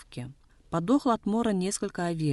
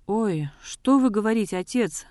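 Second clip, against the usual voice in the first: higher, louder burst of alarm; 6 semitones higher, 6 dB louder than usual. A narrator's reading. Рыкзет to her father-in-law about the clothes, alarmed. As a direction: cry out in startled protest, as a narrator would. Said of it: "всполошилась Рыкзет".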